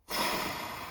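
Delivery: soft, breathed-out sigh; long sigh. Real, not acted. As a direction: let out a long breath after the story. Sighs after finishing the story.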